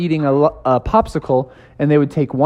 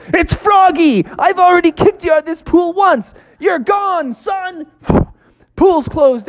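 angry lifeguard; 13 semitones higher than usual. Acted. Lifeguard unmasks Froggy.

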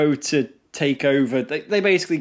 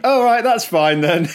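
laughing, higher and louder, careless; laughing casual shrug; 7 semitones higher, 6 dB louder than usual. Retold or imitated. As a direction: toss it off with a laugh, unbothered. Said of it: "And he's like".

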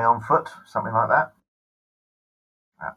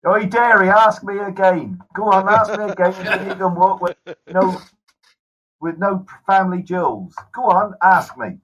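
louder, higher mock-angry dare; mock threat; 6 semitones higher, 4 dB louder than usual. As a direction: dare him to ask once more, half laughing.

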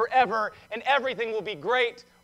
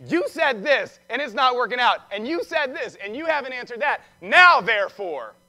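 commanding; 3 semitones higher, 5 dB louder than usual. Acted. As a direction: press each charge, then land a loud demand. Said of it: "Now he's commanding God, saying".

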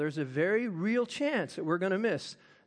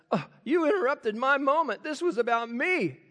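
whiny, higher-pitched mock indignation; mock peeved; 6 semitones higher, 3 dB louder than usual. Man imagining Jesus annoyed at interruption, mock peeved. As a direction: play up being put out by an interruption. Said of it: "he wasn't peeved".

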